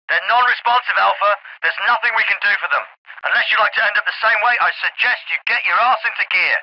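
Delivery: military officer over a field radio; crisp orders through a narrow radio channel; radio transmission. Acted. Military radio transmission, band-limited sound.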